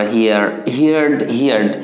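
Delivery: "হিয়ার্ড" said as hee-ard; common mispronunciation. Shown as a demonstration. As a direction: keep the "hear" vowel in "হিয়ার্ড". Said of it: "উচ্চারণটা একটু ভুল করে ফেলি".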